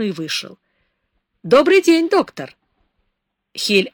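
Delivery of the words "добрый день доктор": loud, high-pitched call of hello; called greeting. A narrator's reading. Driver hailing the doctor from his car, called greeting. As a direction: hail him, loud and much higher.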